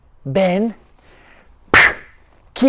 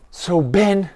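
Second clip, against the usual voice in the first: ordinary delivery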